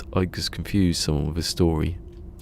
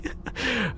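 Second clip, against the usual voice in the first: burst of laughter; 4 dB quieter than usual. Real, not acted. Laughs at own story.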